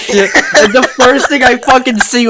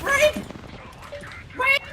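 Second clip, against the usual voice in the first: mock cry for help; 7 semitones higher, 9 dB quieter than usual. Mock cries for help in game.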